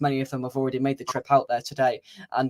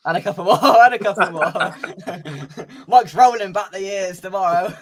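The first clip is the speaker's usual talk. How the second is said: laughing, slow repeat; 5 semitones higher, 5 dB louder than usual. Real, not acted. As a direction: chuckle the words out.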